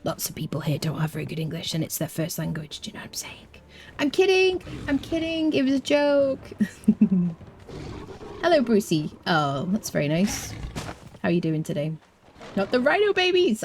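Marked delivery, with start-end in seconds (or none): 2.8-3.5 unvoiced conspiratorial whisper to chat. Real, not acted. Streamer whispers a joke aside to chat, hushed whisper. 4.0-6.4 high playful protest. Real, not acted. Streamer backpedals on joke. 12.5-13.6 high mock-horrified plea. Real, not acted. Pleading about killing baby rhinos.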